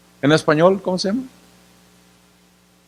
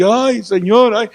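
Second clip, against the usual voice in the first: mock lament; 5 semitones higher, slower than usual. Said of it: "estamos acá llorando".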